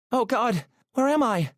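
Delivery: very high, startled woman's voice; alarmed. A narrator's reading. Woman waking from a bad dream in a car, alarmed. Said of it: "her eyes wide and alarmed".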